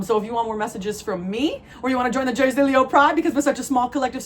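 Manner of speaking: usual speaking voice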